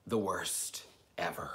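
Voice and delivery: whispered, slow aside. Real, not acted. Complaining to viewers about the Texas drive, whispered. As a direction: breathe it out as a secret.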